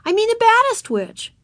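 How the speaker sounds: put-on high, loud, insistent child; contradicting. A narrator's reading. Ramona corrects her older sister, stubborn high child voice. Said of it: "contradicted Ramona".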